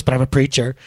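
usual speaking voice

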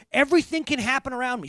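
loud, high, fervent preaching; rising sermon fervor; preaching at full force. Acted. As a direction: proclaim it to the back row.

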